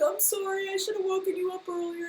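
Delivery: a hint of a sheepish husband; apologetic husband. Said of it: "And he's like".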